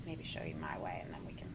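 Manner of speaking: soft unvoiced whisper. Real, not acted. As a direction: drop to a whisper. Aside to new mother.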